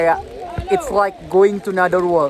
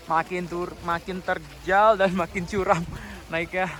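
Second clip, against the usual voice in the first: ordinary delivery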